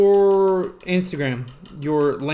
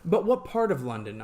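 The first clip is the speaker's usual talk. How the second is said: ordinary talk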